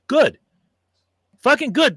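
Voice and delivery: fierce satisfied approval. Real, not acted. The host cheers the arrest of a threatening man, fierce approval. Glad the cops caught him.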